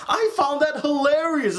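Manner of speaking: ordinary delivery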